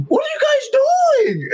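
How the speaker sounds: high-pitched, drawn-out mock shout; imitated yelling. Teammate yelling at the squad in the next game, mock shriek. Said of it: "he's back to yelling at Sam and me".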